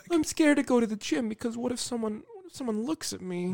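high, soft, timid put-on voice; teasing scaredy-cat impression; mock scared voice. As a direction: mimic a friend's fearful excuse, small and high. Mocks friend's excuse for avoiding gym.

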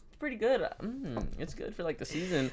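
high, soft sing-song fake praise. Coworker imitating her own fake compliment to the boss. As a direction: sweetly pretend the food is good.

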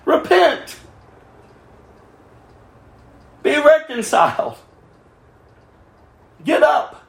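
high, ringing call to repent; urgent exhortation. Real, not acted. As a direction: call them to their feet. Preacher exhorting congregation.

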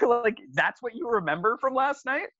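high laughing disbelief; incredulous, still laughing. Real, not acted.

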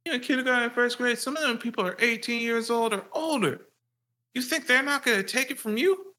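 high mock voice of a lecturing dad. Dad taunting his young son, high mock voice. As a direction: sneer it like the father. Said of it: "dad's taunt resumes".

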